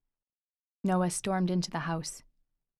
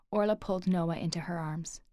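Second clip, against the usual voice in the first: plain reading voice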